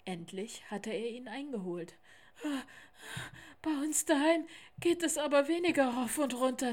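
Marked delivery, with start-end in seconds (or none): 3.6-6.7 panting. A narrator's reading. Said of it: "sagte Lars keuchend".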